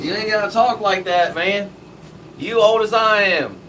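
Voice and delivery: high, loud mock protest; hammed-up offended outburst; indignant comeback. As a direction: shout the objection way up in pitch.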